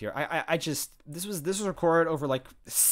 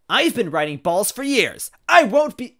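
loud, high character outburst; forceful raised-voice protest; crying out; 8 semitones higher, 10 dB louder than usual. Acted. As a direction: cry it out in indignation. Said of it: "Wendy cried out".

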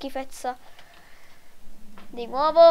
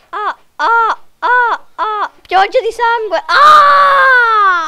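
mock panicked cries; 4 semitones higher, 8 dB louder, much slower than usual. Gore scene in a ragdoll game.

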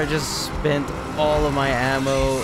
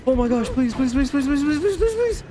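pleading, climbing ever higher and louder; raging gamer; 9 semitones higher, 3 dB louder, faster than usual. Real, not acted. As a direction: beg faster and higher each time. Begging while monster closes in.

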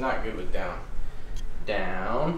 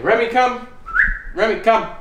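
calling the dog; 10 semitones higher, 4 dB louder, slower than usual. Real, not acted. Calling the puppy to come.

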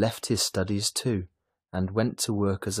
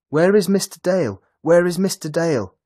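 loud, much higher frantic shout; 10 semitones higher, 10 dB louder than usual. A narrator's reading. Lily bursting in on Mrs Dale, frantic shout. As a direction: yell it out in a panic. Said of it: "the girl shouted".

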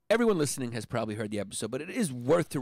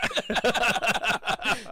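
high, rolling laughter; hearty laugh; 9 semitones higher than usual. Real, not acted. Host laughs at friend choosing Shea Stadium, hearty laugh. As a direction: burst out laughing. Cracks up at Mets story.

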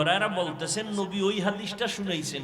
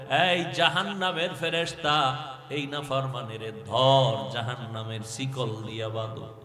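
ordinary talk

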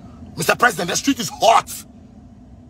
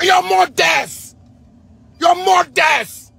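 high, slow, drawn-out angry yell; furious shout; 7 semitones higher, 4 dB louder, much slower than usual. Real, not acted. Cursing the officials who worry the youth, a drawn-out furious yell. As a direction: stretch every word out in pure rage.

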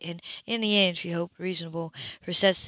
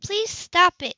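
pleading; 12 semitones higher, 6 dB louder, much slower than usual. Acted. Sybil pleads with sister.

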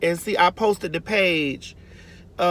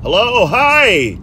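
Timothy Jenner; 5 dB louder, much slower than usual. Retold or imitated.

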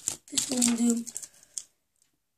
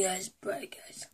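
whispering; 4 semitones lower, 13 dB quieter, slower than usual. Real, not acted. Reacting to the sour candy.

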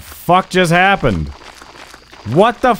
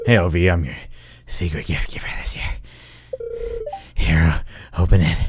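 low, breathy creepy neighbour, dropping to a whisper; Harvey voice; 7 dB quieter, faster than usual. Pretend. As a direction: play a heavy-breathing oddball handing over a gift. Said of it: "Uh-oh, it's Harvey".

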